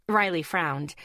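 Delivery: plain reading voice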